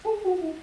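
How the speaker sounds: high, loud "woo" flourish; silly high vocal tag after singing; playful high woo-woos. Shown as a demonstration. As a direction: falsetto woo-woo-woo. Sung flourish after the name.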